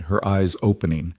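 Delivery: ordinary delivery, nothing marked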